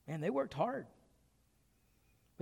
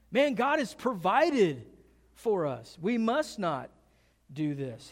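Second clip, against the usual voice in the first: building to a high, loud exhortation; rally them with mounting, insistent force; impassioned preaching; 4 semitones higher, 5 dB louder than usual. Retold or imitated. David urges his brothers to see God has provided, preaching. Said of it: "he starts to preach".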